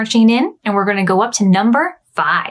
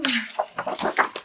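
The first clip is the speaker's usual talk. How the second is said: gasp; 11 dB quieter than usual. Acted. Bernard about to fly.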